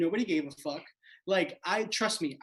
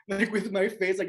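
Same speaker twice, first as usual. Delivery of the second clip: usual speaking voice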